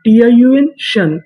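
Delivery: ordinary delivery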